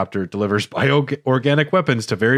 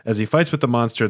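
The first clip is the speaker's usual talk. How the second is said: ordinary delivery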